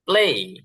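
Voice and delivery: প্লে said as English "pley" with a falling glide; model English pronunciation. Shown as a demonstration. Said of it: "ওরা এভাবে উচ্চারণ করে".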